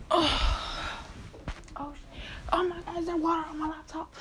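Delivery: she's just spotted water on her laptop, sudden alarm; startled alarm. Real, not acted. Noticing water on her laptop, high startled gasp. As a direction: pitch jumps up high, quick and startled.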